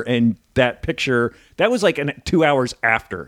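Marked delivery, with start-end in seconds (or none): none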